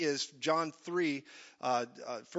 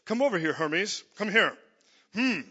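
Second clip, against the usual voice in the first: lightly voiced commanding god, a little louder; hint of a lordly Zeus summoning his messenger; playful imitation of an imperious god; mock Zeus; 3 semitones higher, 5 dB louder than usual. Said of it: "he's like a Zeus up there".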